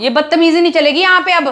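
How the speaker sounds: angry warning. Acted. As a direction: snap back after being splashed. Angry after water thrown. (पानी फेंके जाने पर ऊँची, तेज़ आवाज़ में गुस्सा)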